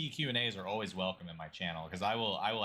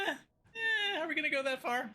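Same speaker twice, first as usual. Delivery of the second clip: hesitant voice. Retold or imitated. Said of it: "but then I was like".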